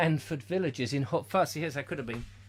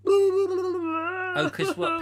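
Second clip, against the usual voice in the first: surprised; 4 dB louder, much slower than usual. Acted.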